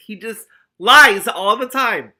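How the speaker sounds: slow, high, loud complaint; outraged exclamation. Real, not acted. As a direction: wail it in mock outrage. Reader outraged at character.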